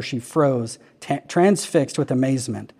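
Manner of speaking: ordinary delivery, nothing marked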